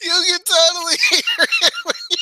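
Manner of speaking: very high, squeaky words through laughing; helpless laughter. Real, not acted. Host amazed by the accent trick, helpless laughter. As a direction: try to talk while cracking up completely.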